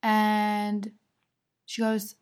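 ordinary talk

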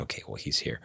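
breathy whisper to himself; whispered aside. Retold or imitated. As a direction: whisper it like a private realization. Whispered thought at locked door.

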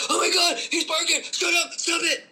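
high, far panicky shriek at the dog, half laughing; hammed-up frantic owner voice; panicked owner. Shown as a demonstration. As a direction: freak out at the barking dog. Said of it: "As opposed to".

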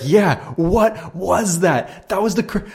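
half-shouting in wonder at what just happened; excited disbelief. Retold or imitated. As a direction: friend freaking out in amazement. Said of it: "And he's like".

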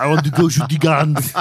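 loud, high-pitched hammed-up Austrian accent; booming mock Austrian action-hero accent; Arnold Schwarzenegger. Podcast host mimics guest's accent attempt as thick Austrian impression. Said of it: "he sounded like Arnold Schwarzenegger".

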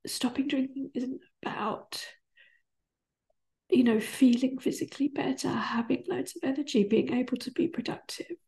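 tearful, high and breaking, half whispered; crying through it, real emotion. Real, not acted. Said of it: "So I'm crying".

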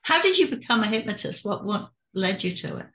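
usual speaking voice

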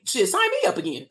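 mock-eager sign me up; excited. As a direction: jump up high like you just heard great news. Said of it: "I was like".

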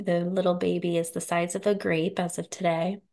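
usual speaking voice

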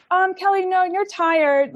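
a hint of a kindly boss talking her down; gentle boss. Retelling her head boss refusing to let her go, lightly soothing.

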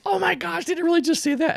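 squeaky mock disbelief; shocked kid. Ellie's shocked reaction voiced.

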